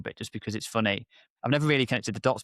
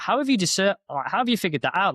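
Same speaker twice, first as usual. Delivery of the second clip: retold disbelief; 8 semitones higher, 4 dB louder than usual. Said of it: "I was like".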